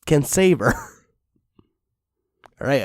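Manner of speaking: ordinary talk, nothing marked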